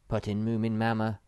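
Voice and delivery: ordinary delivery, nothing marked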